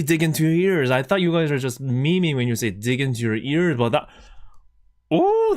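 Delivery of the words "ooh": high, delighted ooh. Real, not acted. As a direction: squeal at the tingle. Reacting to ASMR tingle.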